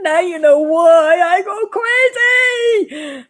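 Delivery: slow, crying shout; anguished cry. Acted. Astronaut driven crazy by the alien's chant, slow crying shout. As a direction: drag it out like you're losing your mind. Biggie cries out, slowed.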